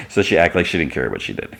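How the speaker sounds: ordinary talk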